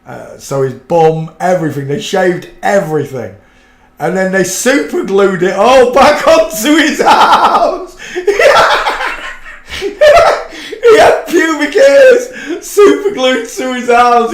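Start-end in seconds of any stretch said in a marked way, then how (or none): 4.0-14.3 slow, high, laughing through each word; laughing slowly. Real, not acted. Describing the superglue prank, slow and laughing. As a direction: fight the giggles to get it out.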